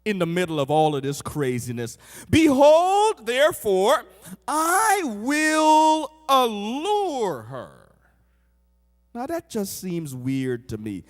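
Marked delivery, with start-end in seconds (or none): 2.3-8.0 raised pitch with long drawn-out words; grand scripture proclamation. Acted. Preacher reads God's vow to the congregation, grandly drawn out. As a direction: proclaim it slowly, stretching each key word.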